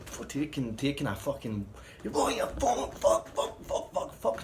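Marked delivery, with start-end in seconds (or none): 2.1-4.4 high, breathless hammed-up cursing; mock frenzied swearing; imitating a rage. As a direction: spit the curses with each kick. Peter kicking toilet rolls in rage.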